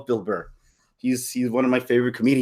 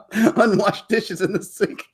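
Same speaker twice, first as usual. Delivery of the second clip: slow, high, chuckling; slow giggling repeat; laughing; 6 semitones higher, slower than usual. Retold or imitated. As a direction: savor the line through laughter.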